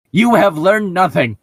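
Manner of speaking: loud, high, booming reproach; a big theatrical yell of scorn; dramatic shout. Acted. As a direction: hurl the line at him in fury. Dramatic line in the story.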